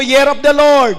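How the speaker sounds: loud call to repeat; congregation repeat. Real, not acted. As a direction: lead the crowd to say it back. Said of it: "Sabi mo". (malakas na pag-uulit ng kongregasyon)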